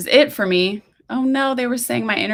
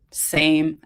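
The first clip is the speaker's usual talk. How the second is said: plain reading voice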